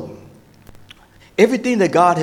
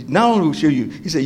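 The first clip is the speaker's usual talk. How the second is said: ordinary talk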